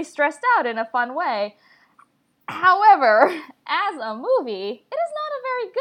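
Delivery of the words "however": loud, high, drawn-out However,; emphatic however. Real, not acted. Critic pivots to her verdict with a big emphatic However. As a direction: lean hard on However, before the verdict.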